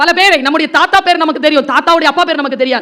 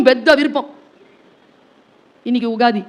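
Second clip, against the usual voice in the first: ordinary talk, nothing marked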